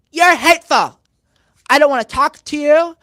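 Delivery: high, loud imitation of an angry heckler; mock hateful outburst. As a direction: spit out each accusation in mock fury.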